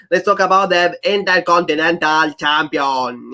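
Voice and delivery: slow, exaggerated imitation of Paul's Nigerian accent. Host mimics how the wrestler talks, exaggerated Nigerian accent. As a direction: stretch the title out in a mock Nigerian accent. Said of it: "that's how he talks".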